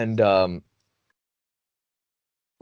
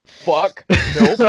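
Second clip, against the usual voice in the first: higher, louder retold alarm; mock panic; 5 semitones higher, 4 dB louder than usual. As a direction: blurt it in comic panic. Acts out panic at spinning room.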